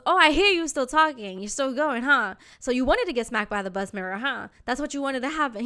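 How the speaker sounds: pointed retold taunt with challenging huhs; taunting intercom telling-off. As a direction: imitate a fed-up driver calling out a passenger. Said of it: "over the intercom".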